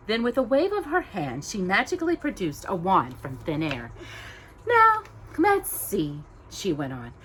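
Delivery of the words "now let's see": put-on high, slow, musing old lady; pondering fairy godmother. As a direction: muse it out playfully.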